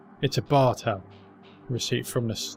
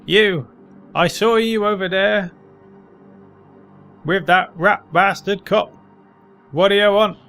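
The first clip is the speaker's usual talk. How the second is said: put-on loud, higher hostile old man; gruff old man; 7 semitones higher, 8 dB louder than usual. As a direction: bark at the stranger like a bitter old man.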